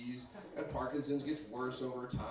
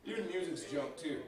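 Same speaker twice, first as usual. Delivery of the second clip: ordinary talk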